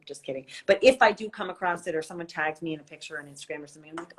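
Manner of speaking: ordinary talk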